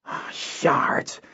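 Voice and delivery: breathy, soft high groaned curse. Acted. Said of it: "Kinden groaned".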